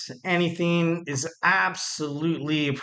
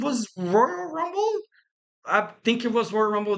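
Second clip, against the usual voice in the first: playfully unsure; 7 semitones higher than usual. Pretend. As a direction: stretch it upward in mock doubt. Drawn-out playful unsure recall.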